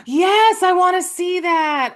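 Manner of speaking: gasp, then high, loud eagerness; excited. Real, not acted. Co-host eager to see the film just mentioned, excited. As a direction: burst out with high-pitched enthusiasm.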